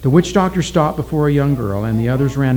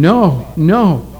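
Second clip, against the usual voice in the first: crying; 4 semitones higher, 5 dB louder than usual. A narrator's reading.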